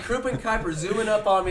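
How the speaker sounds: ordinary delivery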